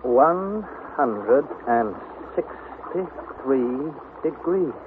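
very slow, drawn-out reading. Acted. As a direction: read the thermometer carefully. Reading the thermometer slowly.

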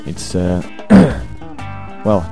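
ordinary talk, nothing marked

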